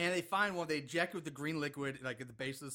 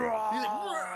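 loud, laughing mock zombie roar; 5 semitones higher, 6 dB louder than usual. Recapping the zombie going ape shit, mock roar. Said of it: "he's like".

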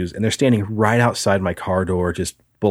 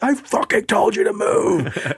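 much higher, hammed-up angry shout; retold shout; 13 semitones higher than usual. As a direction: yell it back like you warned him. Yelling back at the dude.

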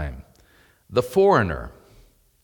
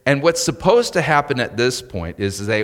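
normal talking voice

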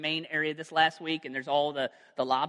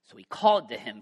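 dramatic Bible reading, louder at the start; scripture reading; 4 dB louder than usual. Acted. Preacher reads Luke aloud to the congregation, dramatic reading. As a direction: read the passage with weight.

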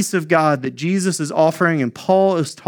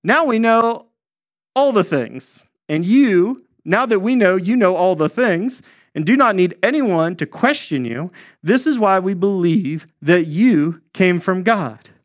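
high, know-it-all voice for the disciples; smug disciples; 5 semitones higher than usual. Retold or imitated. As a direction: lift the pitch and sound pleased with yourselves. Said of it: "His disciples said".

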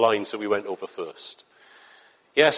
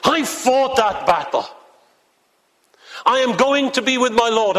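voicing Paul; 11 semitones higher than usual. Paul declaring he has fought the battle, solemn and high. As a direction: speak as Paul looking back on his life. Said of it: "And Paul says".

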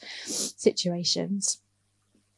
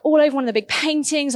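usual speaking voice

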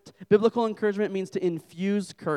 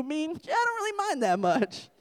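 much higher, squeaky aside; mock nonchalance; 12 semitones higher than usual. Husband admitting it to his wife, high mock nonchalance.

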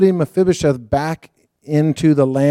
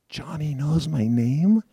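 awestruck disbelief; 7 dB quieter, slower than usual. Retold or imitated. Stunned that she knew him.

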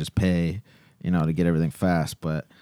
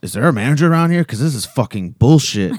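loud, high irate customer; imitating a customer's angry outburst; going off; 5 semitones higher, 7 dB louder than usual. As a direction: demand it, quick and outraged. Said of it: "he's just going off".

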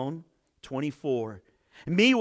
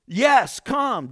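louder, higher invitation; calling out; 6 semitones higher, 7 dB louder than usual. A narrator's reading. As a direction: call him over, inviting. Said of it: "Jesus said".

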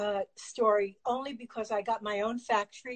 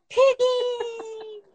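high, loud tearful cry of a name; excited cry; 12 semitones higher, 7 dB louder than usual. Real, not acted. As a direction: call out the dog's name overjoyed. Cries out dog's name.

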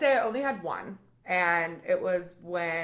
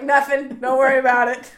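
loud, higher dismissive reply; mock brush-off; 7 semitones higher, 11 dB louder than usual. Brushing off the orphan next cloud, loud mock dismissal. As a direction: brush it off, half laughing. Comic's retold answer to orphan.

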